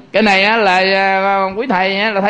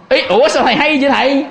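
high, laughing surprised question; mock amazement; 7 semitones higher than usual. Follower amazed that the monk guessed it, mock surprise. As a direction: act astonished, pitched up with a laugh.

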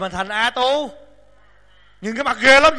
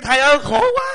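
higher, pleading moan of a tired disciple; whining disciple; 5 semitones higher, 3 dB louder than usual.

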